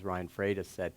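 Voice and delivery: ordinary talk, nothing marked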